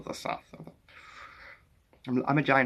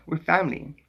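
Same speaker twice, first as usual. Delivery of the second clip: ordinary talk, nothing marked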